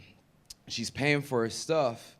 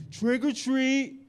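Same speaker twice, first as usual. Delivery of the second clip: put-on high, slow, drawn-out kid's trick or treat; 12 semitones higher, 4 dB louder, much slower than usual. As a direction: sing it out like an eager child. Child voice at door.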